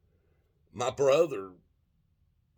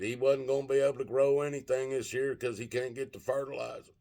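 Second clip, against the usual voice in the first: higher-pitched mocking whine; sneering retold bellyaching, pitched up; mock whining; 5 semitones higher than usual. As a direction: relay a farmer's complaint as a high whine. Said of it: "whining and bitching about it".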